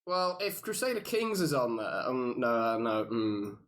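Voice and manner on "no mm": normal talking voice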